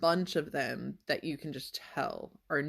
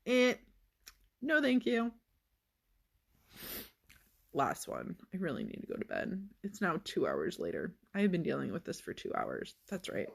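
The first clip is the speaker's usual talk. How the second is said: ordinary talk, nothing marked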